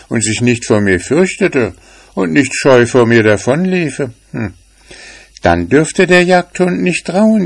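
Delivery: lightly voiced kitten, pondering; little grey cat thinking. A narrator's reading. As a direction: work out the plan to herself.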